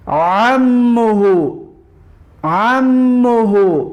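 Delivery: each word drawn out slowly, with the ain pressed in the throat; slow ain drill. Shown as a demonstration. Teacher models ain words slowly for students to repeat. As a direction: model every word twice for the class to repeat. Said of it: "انا همین طور تلفظ بکنید".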